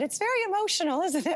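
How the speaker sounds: high, bright South African voice; South African accent. Retold or imitated. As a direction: mimic a South African runner. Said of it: "my South African accent".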